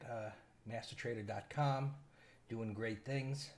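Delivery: normal talking voice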